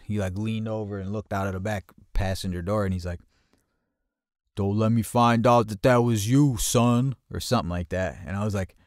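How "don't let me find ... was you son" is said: loud, raised-pitch warning from the driver; loud mock threat; retold threat. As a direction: imitate a driver loudly warning someone off. Retold driver warns him from car.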